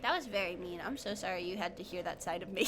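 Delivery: low, hushed voice; soft apology. Real, not acted. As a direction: drop down low and quiet to apologise. Mock apology after the outburst.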